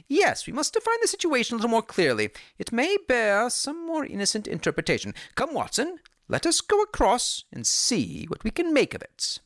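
quick, decisive detective; Holmes voice. A narrator's reading.

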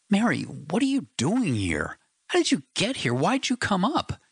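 can't believe she's here; shocked disbelief. A narrator's reading. Husband stunned to find his wife in his tent, shocked and urgent.

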